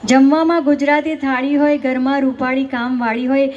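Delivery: normal talking voice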